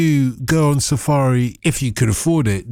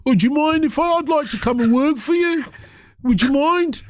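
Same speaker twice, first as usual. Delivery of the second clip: mock Brummie job seeker; 12 semitones higher than usual. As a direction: play a Brummie asking the boss for work. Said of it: "they started speaking".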